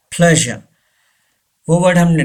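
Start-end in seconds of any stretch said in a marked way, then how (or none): 0.1-0.6 "प्लेजर" said PLEZH-uh; silent final R. Shown as a demonstration. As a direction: drop the R ending "प्लेजर". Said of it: "आर को pronounce नहीं किया जाएगा". (प्लेजर का आख़िरी र silent)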